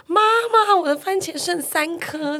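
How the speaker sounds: high mock yell to mom, slowing down; retold shout. Little brother yelling to mom about his tomatoes, mock shout. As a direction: imitate a kid calling out to mom. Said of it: "他就大喊说".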